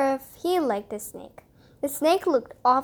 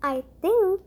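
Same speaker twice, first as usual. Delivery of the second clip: quiet snake voice; 5 semitones higher than usual. Acted.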